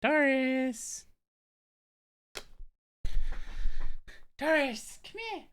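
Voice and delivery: high, sing-song calling of the dog. Real, not acted. Streamer calling her dog Doris to come, high sing-song.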